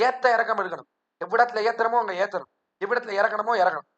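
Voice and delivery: ordinary talk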